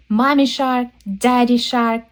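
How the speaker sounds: plain reading voice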